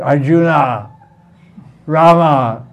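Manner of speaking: ARJUNA, RAMA with heavy drawn-out final A; mock Western pronunciation. Shown as a demonstration. Teacher mimicking the West for students, ARJUNA, RAMA with overdone final A. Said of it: "The West, you all".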